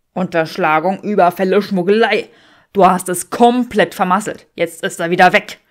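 loud, accusing man's voice; furious partner blaming her; angry Draco. Acted. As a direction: hammer each word at her.

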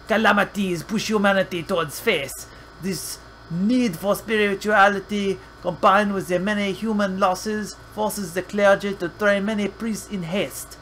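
steady storybook read of game text; reading lore aloud. Acted.

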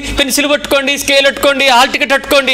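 high, insistent run of little commands; mock exam fussing. Preacher mocks exam-time blessings of pupils, high fussy mimicry.

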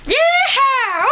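high whooping cowboy yell; hammed-up high cowboy cry; cowboy accent. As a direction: holler it in a mock cowboy accent. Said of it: "Let me do a cowboy accent".

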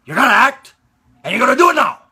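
loud, high forceful shouting; father's tough talk. Acted. Father ordering his shy son to act, forceful shout. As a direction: drive each order home at full voice. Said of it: "I would take him and I would say".

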